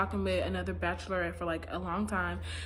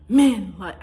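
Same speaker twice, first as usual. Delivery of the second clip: much louder, the voice cracking on Men; crackling voice; 12 dB louder than usual. Retold or imitated. Mimicking Claire's line again, loud and crackling. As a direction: let Men crackle and break. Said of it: "crackles a little bit".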